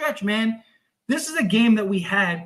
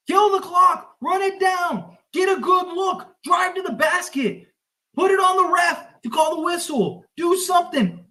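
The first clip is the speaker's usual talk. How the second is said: frustrated yelling; 9 semitones higher than usual. Real, not acted. Fan yelling plays at the Lakers. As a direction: bark orders at the team like a coach.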